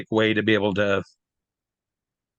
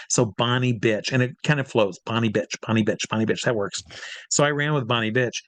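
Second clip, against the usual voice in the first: usual speaking voice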